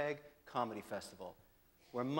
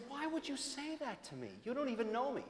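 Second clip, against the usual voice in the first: higher, offended protest; mock hurt surprise, much higher; mock indignant; 11 semitones higher than usual. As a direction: play the wounded innocent, pitch up. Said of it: "I said".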